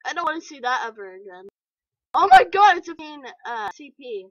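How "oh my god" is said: high, loud oh my god; shocked shout. Real, not acted. Spotting something awful on screen, a high shocked shout. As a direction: blurt it out in shock.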